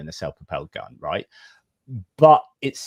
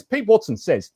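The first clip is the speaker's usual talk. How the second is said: usual speaking voice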